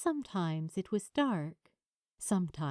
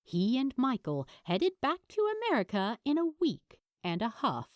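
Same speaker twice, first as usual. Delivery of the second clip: usual speaking voice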